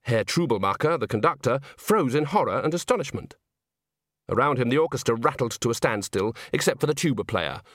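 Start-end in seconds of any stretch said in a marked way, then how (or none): none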